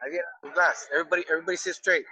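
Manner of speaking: ordinary talk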